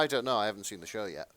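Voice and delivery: ordinary delivery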